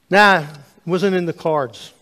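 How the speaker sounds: loud offhand brush-off; dismissive. Retold or imitated. Man dismissing the preacher's worry, loud and offhand. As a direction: toss it away like it's nothing.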